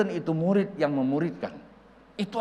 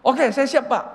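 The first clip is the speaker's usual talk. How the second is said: high, loud ready answer; eager compliance; 9 semitones higher, 8 dB louder than usual. Retold or imitated. Preacher accepts the old pastor's plan, eager and loud. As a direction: answer up loudly like a keen junior.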